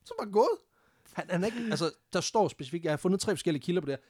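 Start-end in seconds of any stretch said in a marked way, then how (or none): 0.0-0.6 high-pitched, laughing voice; tickled and incredulous; amused disbelief. Real, not acted. Amused at the absurd escape.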